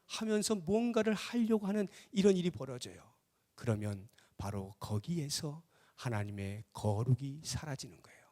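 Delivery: usual speaking voice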